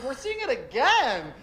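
high, dreamy fan voice; smug fan. Retold or imitated. A fan answering her, lightly voiced smugness. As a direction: say it like a blissed-out devotee. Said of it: "He's like".

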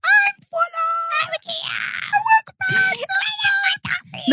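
high squeaky chipmunk voice. Pretend. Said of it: "can we do chipmunk voices?".

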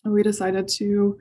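ordinary delivery

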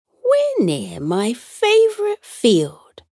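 put-on a fond old aunt, slowly; Tilly's voice. A narrator's reading. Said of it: "said Tilly".

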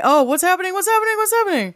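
high-pitched, urgent repeated questioning; frantic excitement. Real, not acted. Co-host frantic for news from the panel, high and excited. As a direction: fire off the question in a high fluster.

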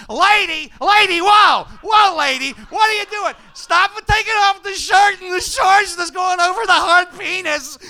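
put-on frantic, high-pitched yelping man-child; very loud, hysterical young goofball; Jerry Lewis. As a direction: shriek in comic panic. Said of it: "I became a fourteen-year-old version of Jerry Lewis".

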